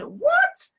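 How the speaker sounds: high, stretched surprised "What?". Shown as a demonstration. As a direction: stretch the vowel in shock. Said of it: "We stretch out the vowels".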